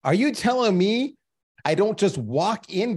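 high, disbelieving cry that slows at the end; stunned disbelief. Retold or imitated. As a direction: play the shocked young VP finding out. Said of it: "And I'm like".

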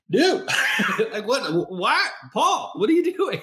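a startled mother staring at her son; bewildered. Retold or imitated. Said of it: "she's like".

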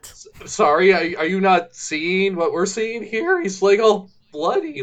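mock baffled girl. Mocking the fashion girl baffled by bloody Earl, high and loud. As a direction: squeal it like an oblivious heroine. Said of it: "It's like".

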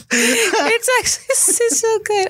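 high squealing laugh through words; laughing hard. Real, not acted. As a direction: laugh it out, can't stop. Bursts out laughing at host's "Gross.

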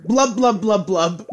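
high gurgling blubs; gurgling fish man croak. Pretend. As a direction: shout the blubs, bubbling. Bubbling Leroy Jenkins while jumping.

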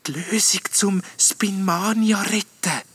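high, slow, hushed ghost voice; hopeful ghostly whisper. A narrator's reading. Little ghost revealing how to save Spinmania, hushed. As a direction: breathe it out slowly, full of hope. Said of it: "flüstert der Geist hoffnungsvoll".

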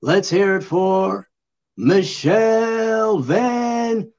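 drawn-out ring announcer intro; slow, building fight-night announcement; boxer announcer voice. Pretend. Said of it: "boxer announcer voice".

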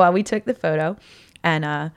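ordinary delivery

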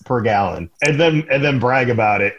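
normal talking voice

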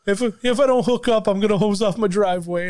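mocking Italian guys. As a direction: ham up the bragging coworker. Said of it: "all they talked about was hosing".

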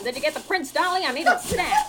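high, loud put-on boss voice; squeaky newspaper boss voice; old-timey boss voice. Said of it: "Who was that?".

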